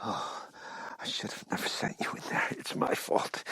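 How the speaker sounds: breathless guilty whisper. Acted. Guilt-stricken over injured girl.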